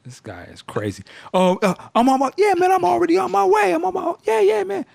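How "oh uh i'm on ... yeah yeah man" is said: much higher, stalling voice of a guy making excuses; hurried phone excuse. Retold or imitated. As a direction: fake it like you're already on the way.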